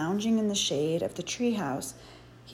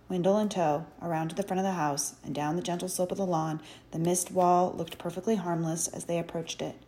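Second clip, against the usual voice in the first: ordinary delivery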